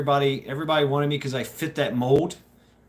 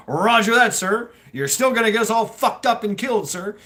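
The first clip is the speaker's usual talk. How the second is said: higher, louder sarcastic "Roger that, sir"; mock military reply; 8 semitones higher, 4 dB louder than usual. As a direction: ham up a cheeky salute to the officer.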